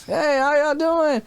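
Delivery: high, drawn-out mock-cheerful greeting; putting on a cheery front. Dad hiding his breakdown from the kids, falsely cheery. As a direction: greet them as if nothing happened. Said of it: "Like, I just didn't fucking break down".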